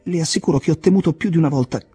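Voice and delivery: high, gushing gratitude; overwhelmed excitement. A narrator's reading. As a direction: pour it out, worked up and high. Jim jumping about, overwhelmed with gratitude.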